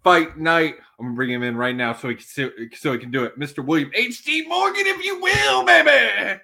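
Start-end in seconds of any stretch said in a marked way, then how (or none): 3.4-6.4 high, loud ring announcer shout; announcer hype call. Acted. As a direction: call him in like a fight announcer. Host calls in guest, announcer hype.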